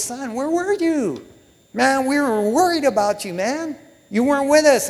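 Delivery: high-pitched fretting mom; acted-out anxious mother, pitched well up; worried mother. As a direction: scold a lost child in a high, worried voice. Acting Mary finding her lost son.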